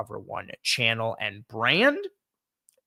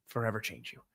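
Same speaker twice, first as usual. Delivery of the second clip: much softer trailing repeat of Forever change you; quiet echo; 2 semitones lower, 11 dB quieter than usual. Real, not acted. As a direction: murmur Forever change you back to yourself. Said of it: "It will forever change you".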